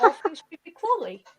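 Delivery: light South London voice; South London accent. Acted. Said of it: "my South London accent".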